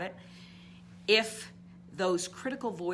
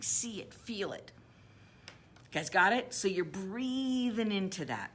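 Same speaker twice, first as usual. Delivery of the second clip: ordinary talk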